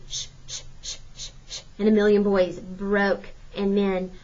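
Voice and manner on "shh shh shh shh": whispered steam-engine shh, shh; soft unvoiced shh of steam; engine hissing. A narrator's reading. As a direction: breathe out the hiss of a train starting. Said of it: "the engine was saying".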